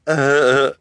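high, loud mocking laugh; villainess laugh. A narrator's reading. Guardian laughing at trapped orphans, high and mocking. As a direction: laugh loudly at your victims.

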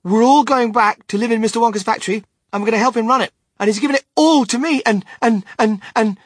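put-on high, loud, breathless-with-news child; boy bursting with excitement, loud and insistent; excited cry. A narrator's reading. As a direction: gush the news and trip over the words. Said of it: "cried Charlie".

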